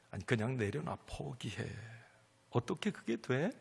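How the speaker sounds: low, hushed taunting devil voice; a quiet, mocking tempter; devil whispering. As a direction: murmur the devil's taunts softly. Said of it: "마귀는 이렇게 우리에게 속삭여요".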